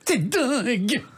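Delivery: high laughing female voice; celebrity impression. Shown as a demonstration. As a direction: exclaim it like a diva. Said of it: "Vous faites aussi bien [name] que Maria Pacôme". (imitation de diva qui rit)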